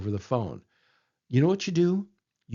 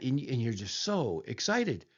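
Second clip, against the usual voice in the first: usual speaking voice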